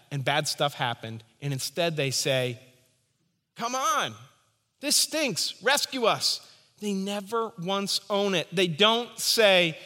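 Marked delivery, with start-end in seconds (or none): 3.5-6.4 high, whiny mock complaint; mock whine. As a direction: whine like a spoiled child begging. Mock whining Israelites to God.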